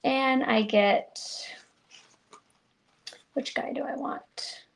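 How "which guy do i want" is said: whisper. Real, not acted. Crafter choosing a die, aside.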